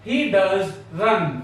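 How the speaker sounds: very slow, He does run spoken word by word; slow dictation. Shown as a demonstration. Teacher dictating an emphatic sentence. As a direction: dictate it slowly, leaning on does. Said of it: "जोर देने के लिए".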